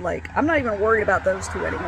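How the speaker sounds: usual speaking voice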